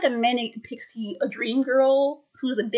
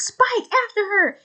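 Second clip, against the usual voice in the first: high, barked order; high commanding character cry; 10 semitones higher than usual. Retold or imitated. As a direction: send him after her with a sharp high call. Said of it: "Crystal goes".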